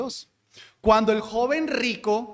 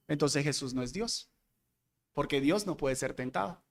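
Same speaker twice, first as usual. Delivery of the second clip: a hint of a doubter, lower and much softer; objector's argument; 6 semitones lower, 8 dB quieter than usual. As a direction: quietly voice the skeptic's conclusion.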